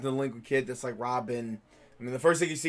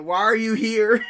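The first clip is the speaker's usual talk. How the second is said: mock exasperation; 6 semitones higher, 5 dB louder, slower than usual. Mock annoyance at love interest.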